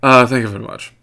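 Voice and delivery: put-on British accent. Host closing the Blueberry read, mock British accent. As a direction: wrap up in overdone British. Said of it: "apparently I'm British".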